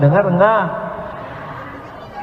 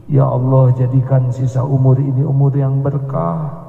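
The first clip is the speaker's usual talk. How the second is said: low, earnest prayer voice; lower, solemn prayer; closing prayer; 6 semitones lower than usual. Acted. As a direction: lead the congregation in heartfelt supplication.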